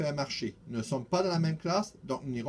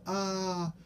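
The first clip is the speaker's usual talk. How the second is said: higher wondering ah; amazed ah; 6 semitones higher than usual. A narrator's reading. Children wowed by the bonsai, higher ah. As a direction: sigh in wonder. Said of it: "d'autres enfants font".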